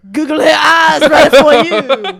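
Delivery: put-on loud laughing Cajun accent. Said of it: "Was that Cajun at the end?".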